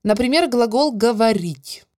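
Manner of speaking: normal talking voice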